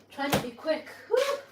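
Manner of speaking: breathless from rushing; out of breath talking. Real, not acted. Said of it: "Look, I'm out of breath".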